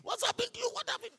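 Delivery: high, frantic mock scream; screaming wife. Wife crying out over her collapsed husband, high mock panic. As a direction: cry out for her fallen husband, hammed-up. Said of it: "Started screaming".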